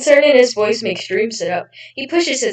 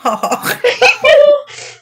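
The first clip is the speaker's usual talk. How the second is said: high, loud put-on British laugh; 7 dB louder than usual. Reading aloud as George, hammed-up British laugh. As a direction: cackle it out like a posh Brit. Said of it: "Gotta do a British laugh".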